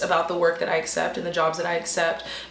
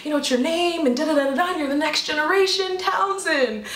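pleading dad; 6 semitones higher than usual. Retold or imitated. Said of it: "my dad was like".

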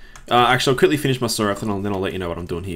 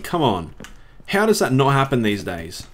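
usual speaking voice

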